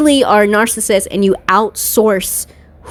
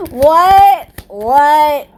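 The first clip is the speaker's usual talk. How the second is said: startled laughing what; 9 semitones higher than usual. Real, not acted. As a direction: laugh then ask in disbelief. Reacting to radar.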